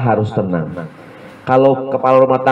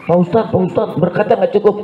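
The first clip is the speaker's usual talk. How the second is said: 1 anxious committee member; 9 semitones higher than usual. Retold or imitated.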